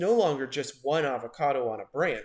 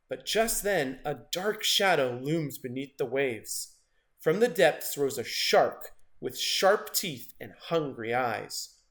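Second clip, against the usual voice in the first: usual speaking voice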